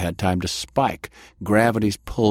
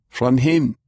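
put-on higher-pitched German-accented voice; 5 semitones higher, 4 dB louder than usual. A narrator's reading. Stranger tells Delilah of his rescue, German accent. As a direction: state it with a firm German accent. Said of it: "he stated with a German accent".

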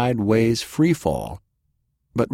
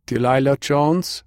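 put-on higher-pitched question in a German accent; 6 semitones higher, 3 dB louder than usual. A narrator's reading. Stranger checks Delilah's identity, German accent. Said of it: "he stated with a German accent".

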